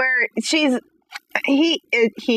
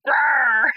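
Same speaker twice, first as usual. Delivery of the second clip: sudden mock growl; imitated puppy growl. Owner showing off puppy's trick, a mock growl. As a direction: bark it out like a tiny angry puppy.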